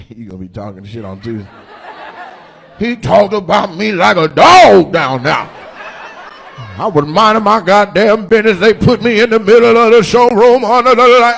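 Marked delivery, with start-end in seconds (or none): 2.8-11.4 put-on high, loud old-man complaint. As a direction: grumble like an old man winded on stairs.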